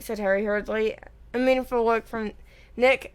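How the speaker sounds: ordinary delivery, nothing marked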